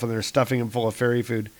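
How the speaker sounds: plain reading voice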